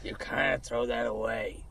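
low, slow growl of a [name] imitation; [name] impression. To his co-host, a slow low [name] imitation. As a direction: drag each word out low and gravelly like [name]. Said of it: "Was that [name]?".

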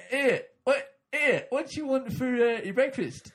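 high, loud, drawn-out genie voice; a cheeky genie asking for an order; comic genie. Acted. Genie in the joke talks to Pontius Pilate, high and loud.

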